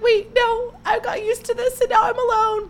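very high, tearful wail; mock sobbing. Podcaster imitating herself left alone after his visit, mock sobbing. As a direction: whine it way up high as if crying. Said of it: "I was sobbing".

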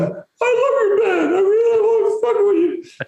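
hammed-up high drunken adoration; acted fawning fan. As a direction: gush in a silly falsetto, mimicking a heckler.

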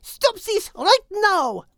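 high, slow hen voice scolding; a French hen putting her foot down; Henriette the hen. A narrator's reading. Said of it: "chimed in Henriette".

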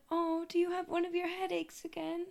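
put-on high, soft little-girl voice; small child gently checking on a parent; concerned child. Daughter finding mom passed out, high soft child voice. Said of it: "She's like".

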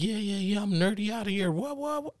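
high, soft mocking nerd voice, lightly voiced; mock nerdy show-off. Mocking a YouTuber flashing cash, in a high nerdy voice. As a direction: squeak out a goofy show-off brag.

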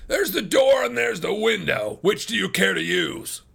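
high, shouted ultimatum; angry cry. A narrator's reading. Farmer offering suitors door or window, furious shout. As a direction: shout them out of the room. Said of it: "cried Ferrier".

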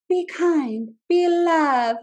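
high, airy preachy sweetness; mock-sweet. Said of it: "sitting here being like".

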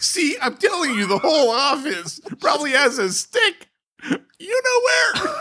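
high, squealing through laughter; real helpless high-pitched laughing delivery; laughing while talking. Real, not acted. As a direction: crack up as you push the joke out. Cracking up at own crude joke.

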